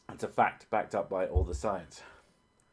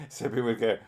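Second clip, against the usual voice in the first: normal talking voice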